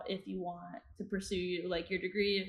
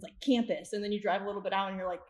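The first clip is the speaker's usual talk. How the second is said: ordinary talk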